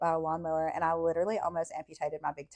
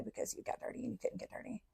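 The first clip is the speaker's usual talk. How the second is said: hushed, secretive whisper; 4 semitones higher, 14 dB quieter than usual. Acted. Telling the audience about the no-dirt rule, secretive whisper. Mocking the caretakers' strict rule.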